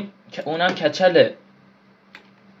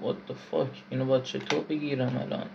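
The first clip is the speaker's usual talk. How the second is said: ordinary talk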